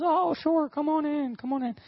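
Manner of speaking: high, singsong welcome; put-on high voice, eagerly inviting someone in; welcoming boss. Senior pastor inviting the staffer in, high welcoming voice.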